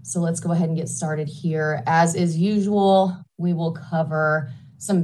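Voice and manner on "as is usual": ordinary talk, nothing marked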